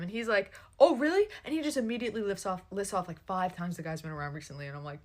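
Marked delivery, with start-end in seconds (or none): 0.8-1.3 louder, higher sceptical comeback; mock challenge. Boyfriend challenging her claim about the other man, sceptical. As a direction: imitate his pointed disbelief. His sarcastic challenge in quarrel.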